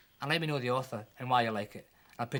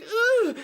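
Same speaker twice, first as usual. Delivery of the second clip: very high, loud yelp of pain; pained howl; 9 dB louder than usual. Acted. Child yelping as the dentist pulls prickles. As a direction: let out a shrill ouch. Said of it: "I shouted".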